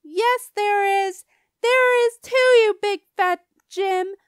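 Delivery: put-on slow, high, full-volume kid yell; angry yell. A narrator's reading. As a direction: yell it back, drawing the words out. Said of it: "I yelled".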